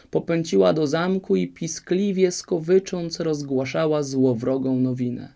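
plain reading voice

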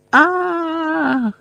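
long high-pitched cry; високий крик. A narrator's reading. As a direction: let out a sustained high "aah". Scream as Neville attacks. (протяжний високий зойк)

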